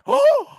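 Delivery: loud, very high mock gasp; shocked gasp. Imitating listeners shocked by the F-bomb, mock gasp. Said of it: "And they're all like".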